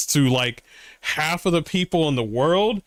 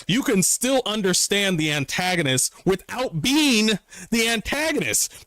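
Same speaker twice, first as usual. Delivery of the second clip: ordinary talk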